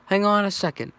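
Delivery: hurried holler in character; calling out. A narrator's reading. Barry holds off the ringing phone, calling out.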